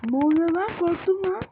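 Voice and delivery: high, loud startled cry; real fright jumping out of the voice; jump-scare shout, high and loud; scared yelp. Real, not acted. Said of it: "Gerçekten korktum".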